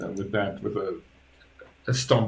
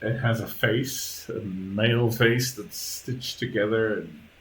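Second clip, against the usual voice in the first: normal talking voice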